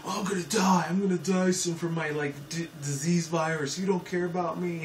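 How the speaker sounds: high, whining complaint; whiny voice. Retold or imitated. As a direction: whine like a self-pitying kid. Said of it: "he kinda sounded like a whiny bitch".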